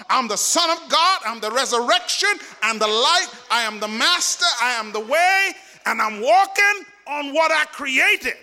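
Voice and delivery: high, driving declarations building in a rolling list; full-force preaching. Acted. Preacher voicing Jesus' claims to the church, full-force preaching. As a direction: proclaim each title with rising force.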